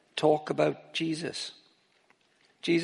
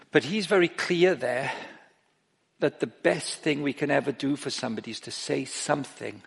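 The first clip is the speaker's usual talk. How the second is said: ordinary talk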